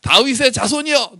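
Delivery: high-pitched acted call; crying out. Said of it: "이렇게 소리 질러요". (높은 목소리로 외치는 흉내)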